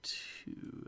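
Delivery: hushed, drifting half-whisper; muttering to oneself. Real, not acted. Player checking their scenes to themself, muttering. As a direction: read it under your breath, drawn out. Reading to self off the script.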